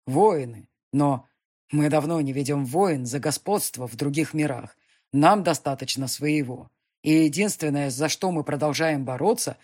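loud, grave demon emperor; commanding lord explaining. Acted. Demon speech.

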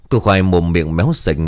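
usual speaking voice

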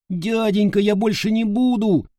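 high wailing plea of fright; howling in pain. A narrator's reading. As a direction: wail it out in pain and terror. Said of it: "взвыл от боли и ужаса Пашка".

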